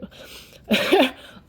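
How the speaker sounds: a bright burst of real laughter. Real, not acted. Laughing after telling the boss off sarcastically, real laugh. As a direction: laugh at your own sarcastic comeback.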